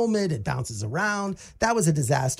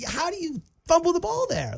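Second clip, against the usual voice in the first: incredulous; 11 semitones higher than usual. Real, not acted. Disbelief at Mahomes' fumble.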